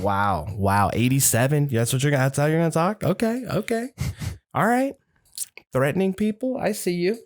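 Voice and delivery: rising, sarcastic wounded reply; mock offended. Grandson to grandma, mock offended sarcastic backing off. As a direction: pretend to back off, sarcastic.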